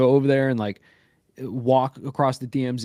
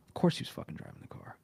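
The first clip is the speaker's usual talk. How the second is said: muttered aside; 3 semitones lower, 10 dB quieter, faster than usual. Real, not acted. Exasperated aside about the arrest.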